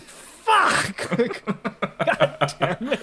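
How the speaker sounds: sudden high, loud yell of disgust; a loud, high "Fuck!" of shock; shouted curse. Retold or imitated. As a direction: blurt it out as you see the piss on your shoes. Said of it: "And I was like".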